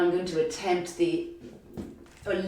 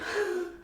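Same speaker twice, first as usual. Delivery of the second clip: quick high laugh at herself; a brief amused laugh; little laugh mid-class; short laugh; 13 semitones higher, 6 dB quieter than usual. Real, not acted. Light laugh at own joke.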